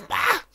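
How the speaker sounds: short, very high yelp; sudden high-pitched scream of alarm; startled shriek. Real, not acted. Startled during play.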